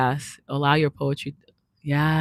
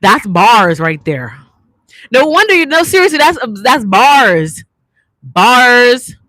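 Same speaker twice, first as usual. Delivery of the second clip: loud, excited praise, high on the last bars; drawn-out excited shout of bars; hyped; 5 semitones higher, 7 dB louder than usual. Real, not acted. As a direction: cheer her lines like a hype fan. Hyped by the poem.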